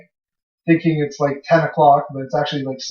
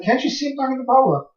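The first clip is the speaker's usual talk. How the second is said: tripping friend; 8 semitones higher, 3 dB quieter than usual. Retold or imitated. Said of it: "He's like".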